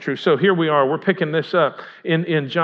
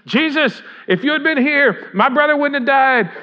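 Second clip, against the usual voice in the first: raised, higher-pitched plea to Jesus; urgent, acted reproach, pitched up; Martha's anguished outcry; 7 semitones higher than usual. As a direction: cry it out as a distraught sister. Acting Martha running out to Jesus.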